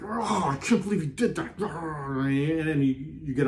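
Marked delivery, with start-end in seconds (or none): 0.0-1.6 higher, put-on voice of someone taking offence; mock indignant. Mimicking someone who resists and takes offence. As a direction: play the person who can't let it go. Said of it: "You're like".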